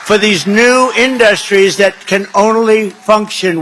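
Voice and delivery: loud, driving rally cadence; rally shout. Acted. Candidate rousing the rally crowd with his energy pledges, loud and driving. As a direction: hammer each pledge out to the crowd.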